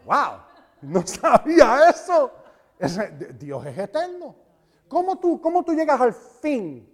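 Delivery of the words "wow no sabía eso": amazed. Retold or imitated. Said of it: "Y tú vas a decir".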